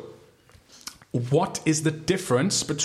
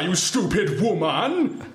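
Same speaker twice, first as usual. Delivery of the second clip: slow, higher mock-indignant voice; buzzer catchphrase; 6 semitones higher, much slower than usual. Friend doing his quiz buzzer line in a silly voice. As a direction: drag out the insult theatrically.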